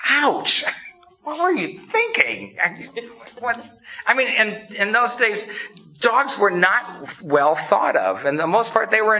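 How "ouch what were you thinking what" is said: shocked. Real, not acted. Reacting to Jesus' harsh words.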